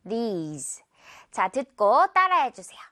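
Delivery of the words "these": These ending on a buzzed z; "These" low and soft, long ee into z; final z sound. Shown as a demonstration. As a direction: finish These with a voiced z. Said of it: "마지막에 Z 발음 처리로 끝내주면".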